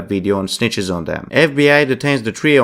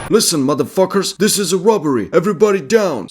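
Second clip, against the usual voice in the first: higher, commanding robber shout; robbery announcement; 7 semitones higher than usual. Retold or imitated. Robber ordering the bank customers down, mock commanding shout. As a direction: bark orders at the hostages.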